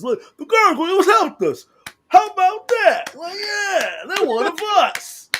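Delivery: high, loud cartoon voices; mock cartoon crowd. Friend mocking the cheesy cartoon ending, high mock voices. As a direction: ham up the grateful townsfolk. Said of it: "they saw the gargoyles".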